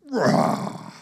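long growled roar; lion roar. Acted. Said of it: "a young lion meets you".

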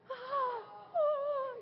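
put-on high squealing "ah, ah" like a scared little girl; high imitated toddler shriek swatting a gnat; child's panicked squeal. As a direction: mimic a four-year-old shrieking at a bug. Four-year-old panicking at a gnat.

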